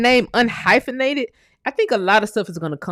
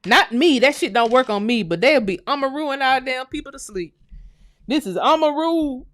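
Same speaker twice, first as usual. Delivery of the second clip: ordinary talk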